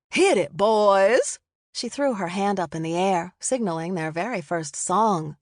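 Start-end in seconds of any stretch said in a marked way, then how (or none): 0.1-1.4 loud, drawn-out call to the band; bandleader call. A narrator's reading. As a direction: launch the band with a big slow holler. Bandleader kicks off the song.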